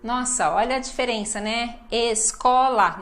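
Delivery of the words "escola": ESCOLA drawn out as es-co-la; every syllable pronounced. Shown as a demonstration. Teacher contrasting escola with English school, every syllable pronounced. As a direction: say all of escola slowly, initial e included. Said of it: "Nós temos que falar tudo".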